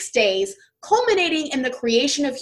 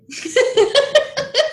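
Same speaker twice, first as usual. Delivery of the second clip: high burst of laughter; 6 dB louder than usual. Real, not acted. Laughing over pet snake.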